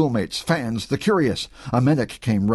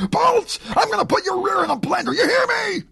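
furious coach. A narrator's reading. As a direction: threaten him at full voice. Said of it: "cursing and fuming".

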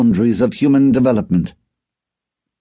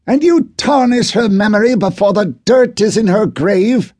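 much higher, louder enraged outburst; furious shout; 12 semitones higher, 3 dB louder than usual. Acted. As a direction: whisper yelling rising to a furious cry. Arthur's furious retort.

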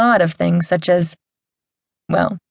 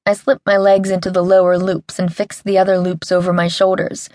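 ordinary delivery, nothing marked

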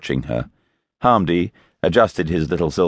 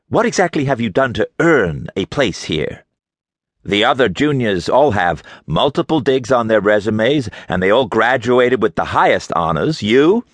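contemptuous scolding; 6 semitones higher than usual. A narrator's reading. Berkeley berates her.

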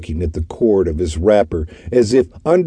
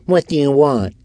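higher, curt and impatient; 8 semitones higher than usual. A narrator's reading. Orton peering through door at visitors, impatient. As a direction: snap it out through the door crack. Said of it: "he asked impatiently".

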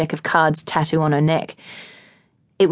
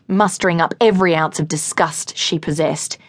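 ordinary delivery, nothing marked

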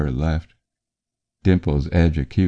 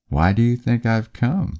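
confident; 7 semitones higher than usual. A narrator's reading. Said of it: "she says confidently".

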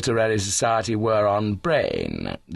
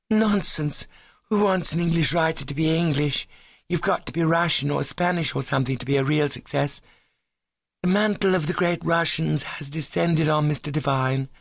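high, lofty ladylike insistence; high-minded girl laying down the law; ambitious young woman, dismissive; 7 semitones higher than usual. Acted. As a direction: brush off the objection, voice held high.

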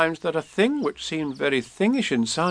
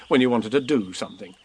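plain reading voice

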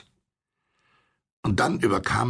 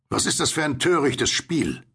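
higher, indignant call; 7 semitones higher than usual. A narrator's reading. Narrator challenges the unseen rower, calling out. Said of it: "rief ich".

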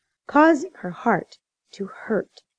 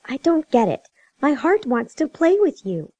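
lightly voiced upset little girl, higher pitched; protesting child; 6 semitones higher than usual. A narrator's reading. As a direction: plead like a puzzled child. Child protesting separation in story.

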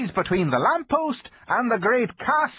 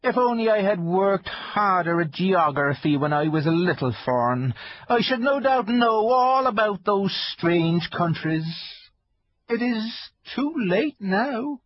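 melancholy; 10 semitones higher than usual. Acted. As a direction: sigh it out with regret. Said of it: "in a rather melancholy voice".

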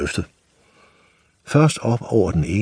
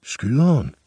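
higher, unsure asking of the word Skyderen; boy Nis echoing the butcher, puzzled; puzzled question; 6 semitones higher than usual. Acted. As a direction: ask it back, bewildered, like a boy. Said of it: "spurgte Nis".

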